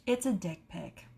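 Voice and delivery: low-key, softly voiced; subdued, confiding voice; deadpan repeat. Real, not acted. Repeats the reveal, now voiced.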